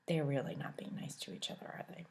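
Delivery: soft whisper to the listener; the reader asking the children; whispered aside. Shown as a demonstration. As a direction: confide it in a hush. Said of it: "aside to listeners".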